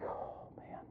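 breathy whisper of relief; relieved whisper. Retold or imitated. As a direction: let it out as a whispered sigh of relief. Relief on seeing son alive.

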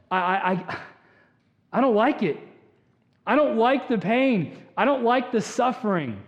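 acted desperate prayer; impassioned plea. Acted prayer begging God for relief.

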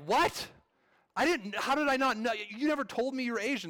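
high, loud, stunned disbelief; shocked husband. Retold or imitated. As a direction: yelp it out like a husband blindsided by news. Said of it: "And you're like".

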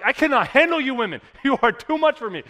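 loud, laughing exasperation; overwhelmed dad. Retold or imitated. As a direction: throw your hands up, laughing at the chaos. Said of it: "And I'm like".

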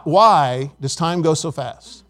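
normal talking voice

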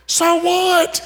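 high, scoffing retort; dismissive "so what". Retold or imitated.

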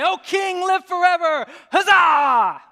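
high, booming crowd cheer; great shout. Acted. People hailing their returning king, great shout. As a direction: shout it out like a town hailing its victor. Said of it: "let out a great shout".

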